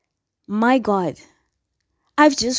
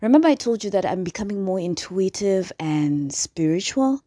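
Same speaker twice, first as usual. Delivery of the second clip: ordinary delivery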